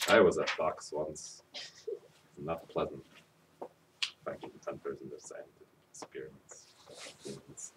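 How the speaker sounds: hushed, breathy secret whisper; confessing a fox past in a whisper; spooky whisper. Acted.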